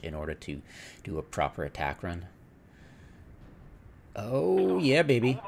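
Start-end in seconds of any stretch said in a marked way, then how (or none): 4.2-5.4 loud, higher whoop of triumph; excited cheer. Real, not acted. Gamer watching his strike land, cheering loudly. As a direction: celebrate the hit out loud. Celebrating a hit in game.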